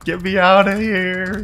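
high, loud cries while being chased; frantic panic. Real, not acted. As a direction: yell for escape, voice climbing in panic. Chased by enemies in game.

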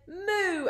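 high drawn-out moo; cow moo. A narrator's reading. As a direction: imitate a cow mooing high. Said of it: "Then the cow said".

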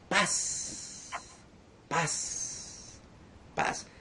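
breathy whisper of "paz", barely voiced; whispered peace. Preacher whispering peace to his listeners, hushed.

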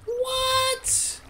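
very high, drawn-out squeak of disbelief; amazed squeak. Real, not acted. Player marvels at axolotls, amazed squeak.

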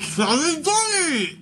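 slow, mushed words through marshmallows; mouth full. Real, not acted. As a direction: force it out through a stuffed mouth.

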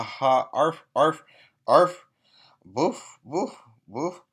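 woofs, growls and arfs, playfully voiced; dog barking noises. Reading the dog sounds to children, barking playfully.

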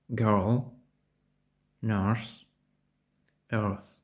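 put-on "Girl", "nurse", "earth" with tongue tip slightly curled up; English ER vowel. Shown as a demonstration. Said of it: "а його кінчик трохи". ("Girl", "nurse", "earth" зі звуком між О та Йо)

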